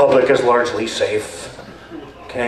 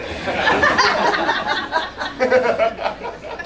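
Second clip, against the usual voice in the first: laughing; 13 semitones higher than usual. Real, not acted. Laughing at own joke.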